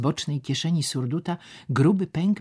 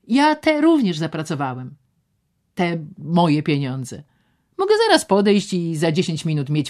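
higher and louder, amused; voiced man boasting; 4 semitones higher, 7 dB louder than usual. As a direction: show her the gaming tables. Szczerbic shows the gaming tables.

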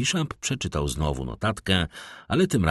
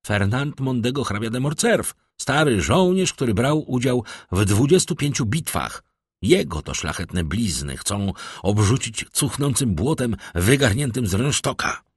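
indignant, higher-pitched defence of a father; agitated; 6 semitones higher, 3 dB louder than usual. A narrator's reading. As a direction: build outrage on behalf of an insulted father. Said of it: "twoje wzburzenie".

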